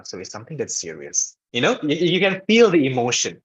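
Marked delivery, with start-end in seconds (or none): 0.0-1.4 soft, lowered, grave tone; low serious voice. Shown as a demonstration. Teacher demonstrating low voice for serious topics, hushed and low. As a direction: bring it down low and quiet. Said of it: "if I'm doing like this".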